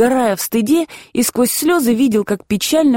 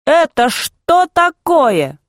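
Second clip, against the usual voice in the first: mother asks word by word, slowly and higher; measured, spaced-out question, pitched up; slow, each word set apart; 6 semitones higher, much slower than usual. Acted. Mother confronting her son, slow and separated. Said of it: "спросила раздельно".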